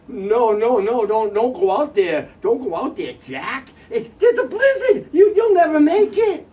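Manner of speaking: loud, frantic plea rising to a cry; panicked warning. Acted. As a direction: beg him not to go out. Bobby begs Jack not to go.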